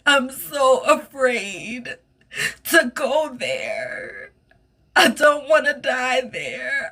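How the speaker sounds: high, slow, sobbing fear; crying in fear. Retold or imitated. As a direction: plead through tears, terrified. Retold sobbing panic in shower.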